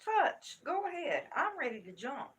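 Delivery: high, loud threat building to a shout; retold hollering. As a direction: holler a protective mother's challenge. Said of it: "I was hollering and screaming".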